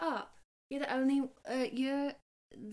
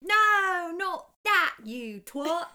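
playful yell; 7 semitones higher, 8 dB louder, slower than usual. Acted. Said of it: "as he says".

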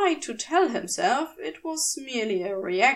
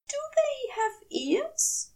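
put-on dreamy childlike question. A narrator's reading.

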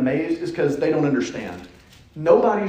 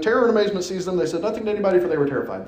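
dramatic scripture reading; 5 semitones higher, much faster than usual. Acted. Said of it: "I end with".

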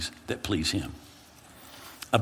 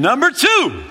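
high, loud counting off; loud retort; 8 dB louder, slower than usual. Retold or imitated. The friend answering the panicked pastor, a loud retort. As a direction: shout back the next reason.

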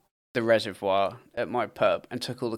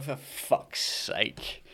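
slow, whispered under the breath; whispered exasperation; 8 dB quieter, much slower than usual. Retold or imitated. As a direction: breathe it out slowly, barely voiced. Muttered to himself, exasperated.

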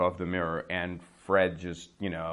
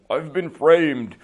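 protesting innocence; 4 semitones higher, 6 dB louder, slower than usual. Retold or imitated. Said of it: "when you talk to those people, like".

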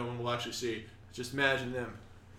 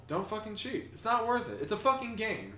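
emphatic lecture; 10 semitones higher than usual. Real, not acted. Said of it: "Here's the memo of the day".

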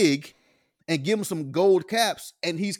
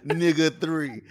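cracking up, louder; laughing while repeating the joke; amused, laughing loudly; 6 semitones higher, 12 dB louder than usual. Real, not acted.

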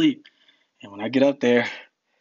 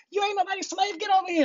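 much higher mother's voice scolding her son; angry mother calling her kid over, pitched way up; mom yelling; faster than usual. Retold or imitated. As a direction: mom ordering him home, high and sharp. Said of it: "Like, yelling at me".

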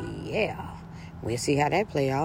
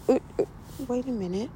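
mock outrage; 8 semitones higher than usual. A girlfriend hearing the ex call while out shopping, high incredulous outrage. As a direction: caught off guard, outraged. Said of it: "I'm like".

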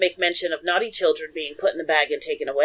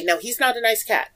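ordinary delivery

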